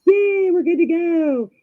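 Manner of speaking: high, slow, loud mock "yay"; acted cheer. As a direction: draw out a hammed-up celebration. Voices her cheer after scrape.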